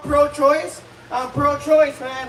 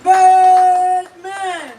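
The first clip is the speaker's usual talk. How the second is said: high, loud, sung-out name; announcer call; 6 semitones higher, 8 dB louder than usual. Real, not acted. Host calling the comic to the stage, drawn-out announcer call. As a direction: stretch the name out like a ring announcer.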